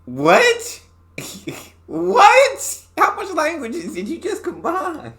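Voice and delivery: high, loud shocked "What?"; stunned what. Real, not acted. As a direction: yelp it in disbelief. Shocked by mixed languages.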